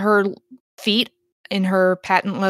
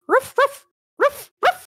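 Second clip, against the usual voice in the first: small yappy dog barking; very high imitated "ruff ruff" yaps; high-pitched dog bark. Dead dog speaking through the medium, high imitated barks. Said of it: "and you hear a".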